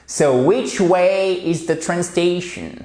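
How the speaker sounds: slow, careful English with a clear way; model question. Shown as a demonstration. Teacher repeating the model phrase slowly for learners. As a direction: stretch which way and point it clearly. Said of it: "il faut absolument dire way".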